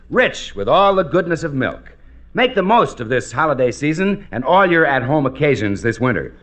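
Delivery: ordinary talk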